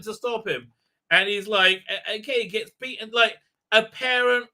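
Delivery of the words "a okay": ordinary talk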